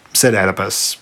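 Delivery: ordinary delivery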